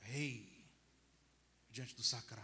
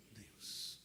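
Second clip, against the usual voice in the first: whispered Deus; 6 semitones lower, 6 dB quieter than usual. Real, not acted. Priest hushing as he names God. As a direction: breathe the word Deus softly. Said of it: "unvoiced final word".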